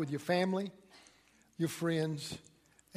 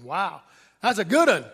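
mock impressed husband; 8 dB louder than usual. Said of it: "and you're going".

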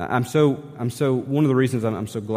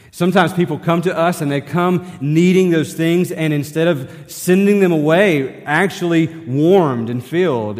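louder, higher preaching swell; full-force preaching; 7 semitones higher, 9 dB louder than usual. Acted. Pastor pressing the congregation to act, full-force preaching. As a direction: drive the point home from the pulpit.

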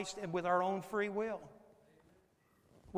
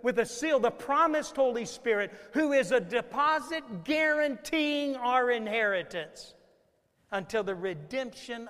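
full-force preaching; 7 semitones higher, 11 dB louder than usual. Acted. Preacher driving home God's seal to the church, loud and high. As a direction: thunder it out to the congregation.

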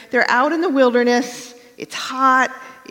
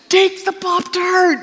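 higher, slow, firm insistence; just take it, kid, said with mock impatience; a pushy, drawn-out order; exasperated command; 10 semitones higher, 5 dB louder than usual. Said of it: "And Bill was like".

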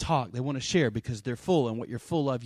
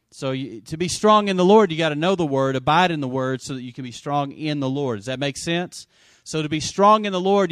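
full-force preaching; 6 dB louder than usual. Acted. To the church, urging them to be strong in the Word, preaching hard. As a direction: drive it home to the congregation.

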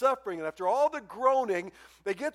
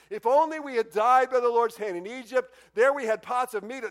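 loud, higher-pitched lament; mock complaining; 5 semitones higher, 7 dB louder than usual. Hungry Israelites grumbling to Moses about food, loud complaining. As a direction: bemoan it loudly, as acted grumbling. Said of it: "Now they're complaining about food".

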